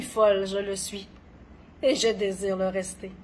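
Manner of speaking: dramatic poetry reading with long pauses; poem recitation. Acted. Poet performing own poem on gentle madness, dramatic recitation. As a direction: recite each line deliberately, swelling and falling in intensity.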